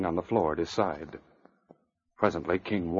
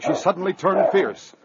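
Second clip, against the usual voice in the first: high, loud, dramatic narration; excited radio narrator at full tilt; urgent action narration; 9 semitones higher, 6 dB louder than usual. A narrator's reading. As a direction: drive the story through a frantic attack. Narrator telling the dog's attack.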